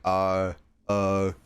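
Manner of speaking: "uh, uh" standing in for a failed R. Shown as a demonstration. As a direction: say R as a drawn vowel, "uh". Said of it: "kinda like a, uh sound".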